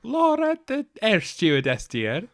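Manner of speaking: high, loud Scottish nanny impression; Missus Doubtfire. Teasing co-host about Laura, put-on nanny voice. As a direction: hoot it like a Scottish old dear. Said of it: "You're doing like a Missus Doubtfire".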